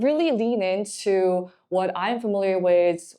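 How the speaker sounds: ordinary delivery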